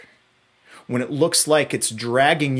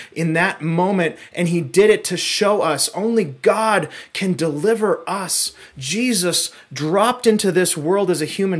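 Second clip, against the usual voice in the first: louder, driving sermon delivery; preaching at full force; 5 semitones higher, 7 dB louder than usual. Acted. Pastor preaching to the church, fervent and loud. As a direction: preach it hard to the congregation.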